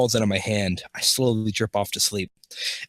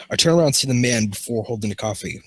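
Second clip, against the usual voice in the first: ordinary delivery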